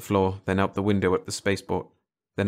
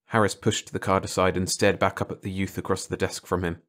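ordinary delivery, nothing marked

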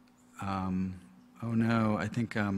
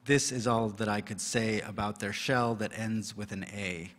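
usual speaking voice

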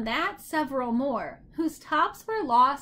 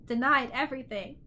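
usual speaking voice

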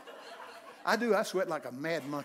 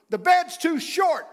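high, loud, slow verse; big pulpit holler; mock proclamation; 13 semitones higher, 13 dB louder, slower than usual. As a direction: proclaim it slowly like grand scripture. Proclaims a comic Bible verse.

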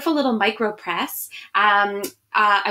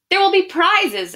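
put-on higher and louder, teasing the kids with prizes; excited promise; 8 semitones higher, 5 dB louder than usual. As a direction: sing it out like a grandma baiting the children. Grandmother promises prizes to children.

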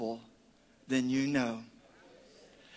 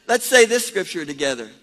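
ordinary talk

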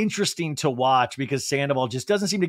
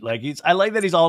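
ordinary talk, nothing marked

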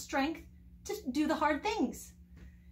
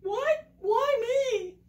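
higher, startled protest; alarmed what, why me; 8 semitones higher, much slower than usual. Retold or imitated. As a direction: shrink back, caught off guard. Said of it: "He hid his face and said".

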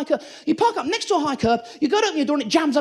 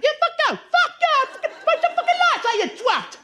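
high mock road rage rant; 12 semitones higher than usual. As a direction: rage at the driver ahead.

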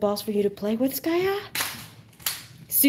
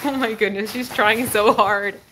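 ordinary talk, nothing marked

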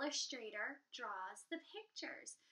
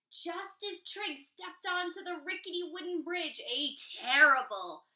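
usual speaking voice

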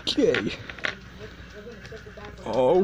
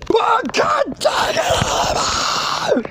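high, slow shouted outburst; exasperated rage; 9 semitones higher, much slower than usual. Real, not acted. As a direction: cry out, stretching every word. Gamer raging at failure.

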